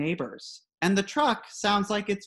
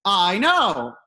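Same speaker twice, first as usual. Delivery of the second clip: loud called-back agreement; mock holler of agreement; 4 semitones higher, 8 dB louder than usual. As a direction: holler it back across the yard, higher and louder. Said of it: "my father hollers back".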